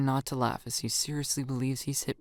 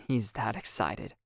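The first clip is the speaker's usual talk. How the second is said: plain reading voice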